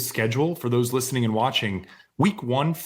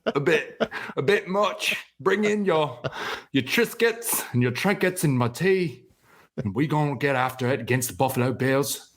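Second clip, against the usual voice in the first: hammed-up British tough-guy accent, pitched up; Jason Statham; 2 semitones higher than usual. Co-host redeems his failed accent with a hammed-up British act. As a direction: play a mock British hard man, tickled by it. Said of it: "I just gotta talk Jason Statham".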